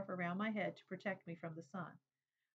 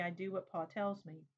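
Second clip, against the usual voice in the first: plain reading voice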